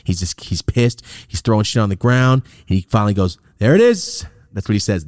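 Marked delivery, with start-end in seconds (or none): 3.6-4.2 high, loud gotcha; triumphant mock cop. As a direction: cop crowing at his find.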